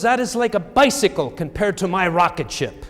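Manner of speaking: high, loud put-on French accent. Seatmate mocks his BlackBerry, high loud mock French accent. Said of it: "in kind of this French accent".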